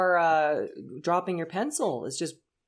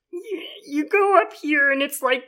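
higher, louder pinched throat voice; tight pinchy voice; 6 semitones higher, 6 dB louder than usual. Shown as a demonstration. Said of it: "the tight, pinchy side".

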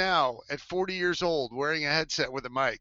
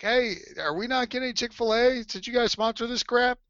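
higher indignant complaint; 7 semitones higher than usual. Retold or imitated. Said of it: "I'm like".

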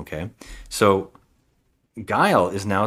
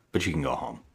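Van Damme; 5 semitones lower, 8 dB quieter than usual. Retold or imitated. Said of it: "when he keeps saying".

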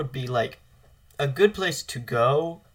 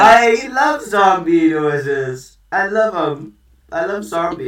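zombie; 5 semitones higher, 7 dB louder, slower than usual. Pretend. Zombie voice while loving zombies.

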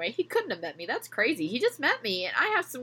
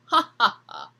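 loud, higher hammed-up fake laugh; 3 semitones higher, 4 dB louder than usual. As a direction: laugh falsely like a host. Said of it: "with a fake laugh".

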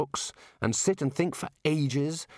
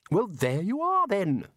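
closing the argument; 8 semitones higher than usual. A narrator's reading. Ridcully ends the dispute with Ponder, triumphant. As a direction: slam the door on the debate. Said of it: "in a closing the argument voice".

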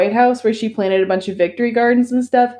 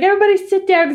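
mock calling out; 12 semitones higher, faster than usual. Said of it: "she's like".

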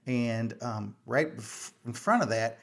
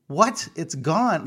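higher-pitched, mock-alarmed outburst; retold shock, pitched up; alarmed disbelief; 5 semitones higher, 5 dB louder, much slower than usual. Arachnophobe reacts to daughter losing the spider, alarmed disbelief.

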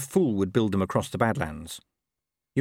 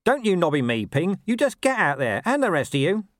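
higher, louder retort, stress on don't; Nobby snapping back; 8 semitones higher, 4 dB louder than usual. Acted. Nobby snaps at Ping and the others, loud and high.

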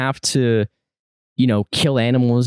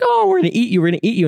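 put-on very high, sing-song teasing of a baby animal; playful girl to bear cub; 12 semitones higher, faster than usual. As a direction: coo at the cub in a squeaky little-girl voice. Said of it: "And she's like".

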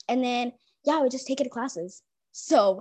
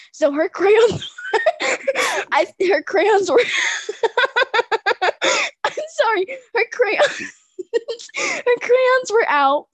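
real high, loud helpless laughter through the words; laughing too hard to finish; cracking up; 11 semitones higher, 6 dB louder, slower than usual. Real, not acted. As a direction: struggle to get it out between laughs. Cracking up while telling story.

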